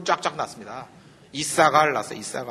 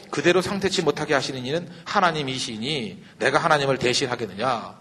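normal talking voice

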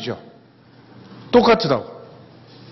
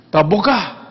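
a touch of a snappish mother; curt, annoyed brush-off to the daughter; irritated refusal; 3 semitones higher, slower than usual. Retold or imitated. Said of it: "짜증".

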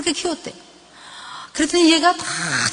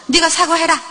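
higher-pitched mock command; bossy parent; 5 semitones higher than usual. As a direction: order the child to apologise.

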